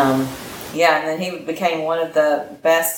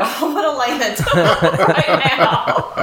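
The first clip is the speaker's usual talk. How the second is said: higher, breaking up with laughter; laughing through words; 4 semitones higher, 3 dB louder than usual. Real, not acted. Pilot retelling her scary mountain flight, laughing through it. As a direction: laugh your way through the line.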